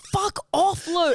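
high mock yell at little sister; annoyed big brother. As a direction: shoo her away, exasperated. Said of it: "Chris is going".

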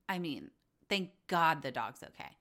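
ordinary delivery

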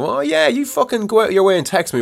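sarcastic outburst. Retold or imitated. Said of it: "I said to myself".

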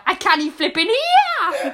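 loud, high Geordie complaint; hammed-up Geordie outcry. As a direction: shout it in Geordie, half-deaf. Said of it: "I've gone Geordie now".

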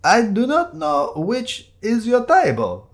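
high, slow, laboured denial; indignant damp man. Acted. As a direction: protest slowly in a high voice. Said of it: "said the damp man".

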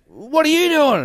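high, loud yelled question up to the balcony; mock shout. Crazy man shouting up at the narrator, mock shout. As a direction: shout up at the onlooker. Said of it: "and he goes".

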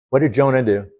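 usual speaking voice

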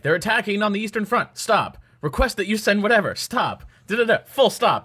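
clipped high telegram read. Retold or imitated. Mock telegram read aloud.

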